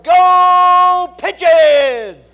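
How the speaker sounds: huge drawn-out high holler; full-volume yell, stretching the first word. Retold or imitated. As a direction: holler it like a boy across a packed auditorium. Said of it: "his eleven-year-old brother hollered".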